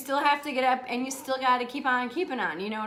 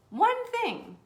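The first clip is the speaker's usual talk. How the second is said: ONE said high and punched, falling; emphatic; 9 semitones higher than usual. Shown as a demonstration. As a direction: hit the word one hard and high. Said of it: "That's it".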